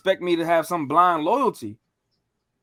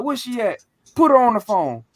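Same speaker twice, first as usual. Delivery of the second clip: high, insistent demand, louder at the end; imitated angry demand; 5 semitones higher, 5 dB louder than usual. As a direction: act out an uncle demanding the next person. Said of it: "the same thing".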